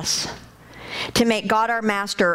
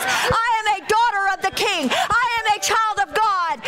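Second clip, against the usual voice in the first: defiant shout; 8 semitones higher than usual. Shown as a demonstration. A preacher shows the church how to rebuke the devil, high defiant shouting. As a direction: roar it at the devil like a fighter. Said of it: "this is what you gotta do".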